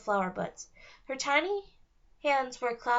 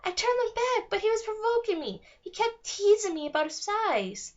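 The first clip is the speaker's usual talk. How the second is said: upset fairy; 9 semitones higher than usual. A narrator's reading. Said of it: "and cried some more".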